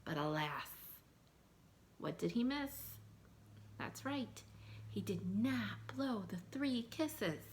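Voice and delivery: ordinary delivery, nothing marked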